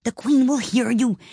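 high, anxious voice; frightened warning. A narrator's reading. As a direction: warn them fearfully of being overheard. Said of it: "whispered in a frightened tone".

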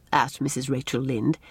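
plain reading voice

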